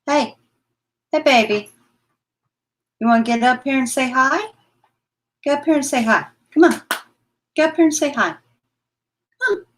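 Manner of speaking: high cooing coax. Real, not acted. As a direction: coo at the pet to come up. Coaxing pet onto lap.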